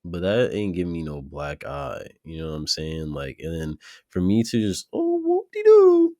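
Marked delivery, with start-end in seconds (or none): 4.9-6.1 high mock singsong, slowed. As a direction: sneer it out in a silly high voice. Mocking the careless excuse.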